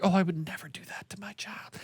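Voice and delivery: high, hushed mock-pious voice; mocking soft parents. Dad mocking lenient parents, hushed high sanctimony. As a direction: imitate a precious parent, high and whispery.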